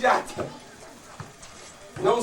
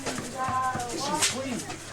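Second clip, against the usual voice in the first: soft, slowed and hushed; tender narration; 8 dB quieter than usual. A narrator's reading. Storyteller describes the mother hugging her boy, soft and tender. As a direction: let it sink into a gentle hush.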